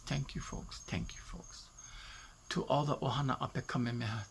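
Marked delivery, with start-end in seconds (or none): 0.0-0.8 hushed thanks. Real, not acted. Thanking mourners, repeated softer. 0.9-1.6 breathy whisper, much softer, fading away; whispered thanks. Real, not acted. Thanking the folks at her brother's service, fading to a whisper.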